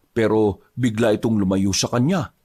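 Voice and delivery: ordinary delivery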